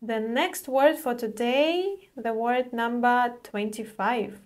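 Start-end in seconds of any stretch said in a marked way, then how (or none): none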